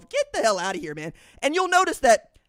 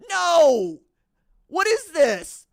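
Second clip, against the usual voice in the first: incredulous; 3 semitones higher, 5 dB louder, much slower than usual. Pretend. Mock outrage at conference groupings.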